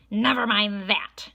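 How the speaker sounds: loud sharp snap of a strict teacher; snapped. A narrator's reading. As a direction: cut them off hard. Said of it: "snapped Miss Swamp".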